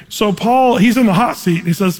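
ordinary delivery

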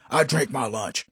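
deadpan coworker. Retold or imitated. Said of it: "he used to tell me".

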